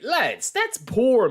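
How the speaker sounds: dragged-out, very slow let-down; slow disappointment. Real, not acted. Streamer laments to chat, very slow and let down. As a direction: stretch every word in dismay.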